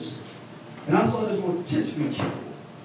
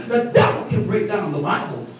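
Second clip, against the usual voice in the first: ordinary talk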